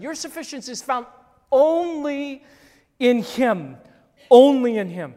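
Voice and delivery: preaching. Acted. Pastor drives the point home to the church, preaching at full force.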